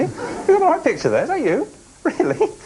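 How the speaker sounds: high-pitched, incredulous laughter through the words; cracking up. Real, not acted. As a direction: struggle to talk through real giggles. Host cracks up at contestant's photo.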